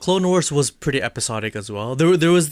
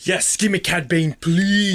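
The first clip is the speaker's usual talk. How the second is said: higher, eager fan plea; excited pleading; 4 semitones higher, slower than usual. Real, not acted. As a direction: beg for it, thrilled. Fan begging for favourite villain.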